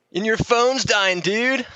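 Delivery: high, slow dopey bro voice; the stoned friend piling on bad news; gnarly hippie. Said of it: "gnarly hippies showing up".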